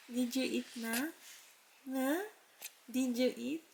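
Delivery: soft, quiet cooing. Real, not acted. Mother cooing to baby. (malambing na bulong sa baby)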